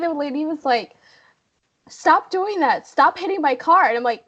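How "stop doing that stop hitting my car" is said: high-pitched mock scolding; imitated arguing. Lady arguing with the driver hitting her car, mock outrage. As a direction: order it to stop in a raised, imitated voice. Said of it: "listen to them argue".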